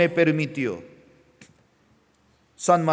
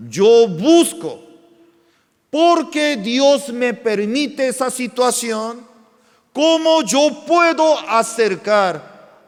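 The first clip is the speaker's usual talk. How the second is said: raised, emphatic preaching voice; 6 semitones higher, 4 dB louder than usual. Acted. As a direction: drive each phrase home to the congregation. Pastor preaching to congregation.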